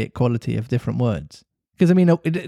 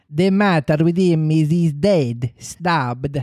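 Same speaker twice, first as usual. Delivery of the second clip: slow, hammed-up Italian accent; 2 semitones higher, slower than usual. Italian pirate reporting a stabbing to Hook, Italian accent. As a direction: give the bad news with a heavy Italian accent. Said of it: "Do Italian".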